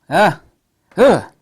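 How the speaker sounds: loud, high gasp then groan of pleasure; gasps of delight. Real, not acted. Reviewer groaning with delight at lens sharpness. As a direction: gasp out loud over the photos. Drooling over lens sample images.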